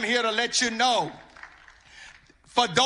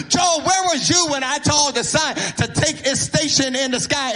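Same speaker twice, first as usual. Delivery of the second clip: preaching at full force; 2 semitones higher than usual. Acted. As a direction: hammer each "Job, where were you" like God demanding an answer. Preaching God's questions at Job.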